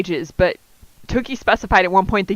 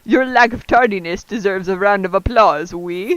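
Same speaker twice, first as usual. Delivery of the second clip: put-on thick French accent. Guru Applause greets the students in a thick French accent. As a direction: greet the girls like a flamboyant French couturier. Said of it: "in a thick, treisurely accent".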